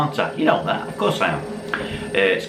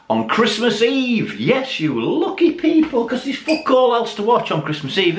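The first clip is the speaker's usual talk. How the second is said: mock TV announcer; 12 semitones higher than usual. Mock announcing his Christmas Eve video.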